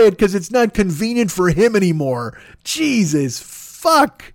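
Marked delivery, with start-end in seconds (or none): 2.6-4.3 exasperated groan. Real, not acted. Exasperated at postal destruction.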